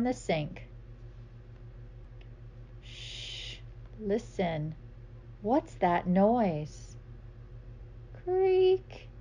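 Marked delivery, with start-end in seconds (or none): 2.8-3.6 soft breathy shush, barely voiced; whispered shush. Hushing refrain. 4.0-7.0 slow curious question. Acted. Story refrain. 8.2-9.1 high creak, voice drawn out; creaky door sound. Acted. Imitating a creak.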